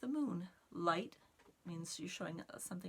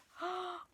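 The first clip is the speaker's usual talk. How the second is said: delighted gasp; 13 semitones higher than usual. Acted. Butterfly reveal in story.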